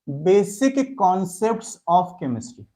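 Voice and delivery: very slow, every word drawn out for note-taking; slow dictation. Shown as a demonstration. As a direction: dictate the chapter title at writing speed. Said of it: "chapter की heading देंगे सबके सब".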